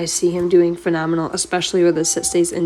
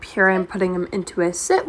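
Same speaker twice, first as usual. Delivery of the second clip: ordinary talk, nothing marked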